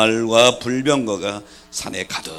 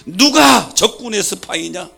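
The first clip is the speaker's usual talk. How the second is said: high, loud furious bellow; bellowing king; 11 semitones higher, 12 dB louder than usual. Retold or imitated. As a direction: roar at the officers. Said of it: "아람 왕이 호통을 칩니다".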